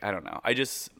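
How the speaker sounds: normal talking voice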